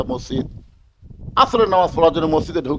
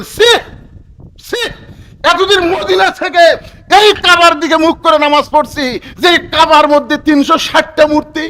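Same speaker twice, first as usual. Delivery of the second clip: loud, high-pitched outraged protest; acted indignant outcry; 10 semitones higher, 8 dB louder than usual. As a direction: cry out in appalled disbelief as Omar would. ওমরের ক্ষুব্ধ প্রতিবাদ অভিনয়. (ক্ষুব্ধ, উঁচু গলায় প্রতিবাদ)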